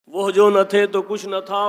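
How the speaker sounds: the couplet ringing out loud; sung-out naat line, loud; loud recitation. Shown as a demonstration. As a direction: declaim the verse with full force. Couplet repeated with full force.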